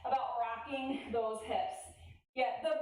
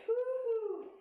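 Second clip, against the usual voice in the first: cheer; 10 semitones higher than usual. Real, not acted. Cheering after glute bridge.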